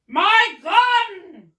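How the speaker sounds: high, loud, stretched demand; furious shout. A narrator's reading. Duke demands his gun from gardener, furious shout. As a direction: bellow for it in outrage. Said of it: "the Duke was shouting to the gardener".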